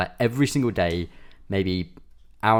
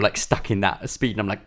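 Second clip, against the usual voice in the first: ordinary delivery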